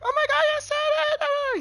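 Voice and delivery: high, loud squeal of delight; excited squeal. Retold or imitated. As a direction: shriek it like she just won. Girlfriend thrilled he said it.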